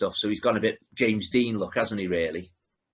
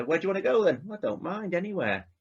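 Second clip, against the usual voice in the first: imitating Rodney and Neris; 8 semitones higher, 5 dB quieter than usual.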